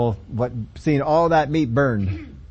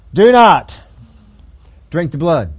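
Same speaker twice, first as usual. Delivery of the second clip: much louder and higher shout on Do not; shouted command; 4 semitones higher, 10 dB louder, slower than usual. Acted. Preacher voicing the old law to the crowd, shouting. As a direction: thunder the prohibition from the pulpit.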